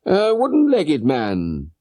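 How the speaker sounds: slow, mildly surprised question; bland surprise. Acted. Holmes hearing of the wooden-legged man, mild surprise. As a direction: feign a calm surprise. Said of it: "with bland surprise".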